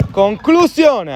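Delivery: high, slow, loud shot cry; excited shot call. Real, not acted. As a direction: let the word soar, thrilled. (grido di tiro acuto e allungato)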